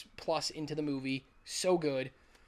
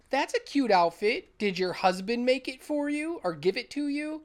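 mocking quip; 8 semitones higher, 4 dB louder than usual. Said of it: "he's like".

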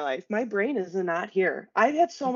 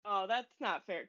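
ordinary talk

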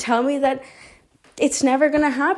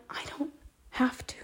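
whisper; 13 dB quieter than usual. Real, not acted. Sudden aside.

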